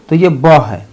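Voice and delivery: ordinary talk, nothing marked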